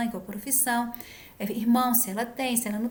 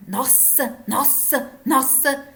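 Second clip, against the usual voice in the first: repeated exclamation building louder each time; imitated exclaiming; 4 dB louder, much slower than usual. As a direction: mimic an agitated patient repeating herself, rising to loud. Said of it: "e fica gritando".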